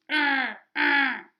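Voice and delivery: loud wailing moan; moaning cry. A narrator's reading. Dogs wailing as the garbage truck takes the food. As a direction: wail it as the feast is lost. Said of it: "moaned the dogs".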